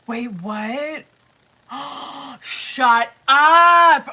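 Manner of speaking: shocked gasp into a loud cry; surprised. Real, not acted. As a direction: disbelief building to a shout. Shocked by drawn card.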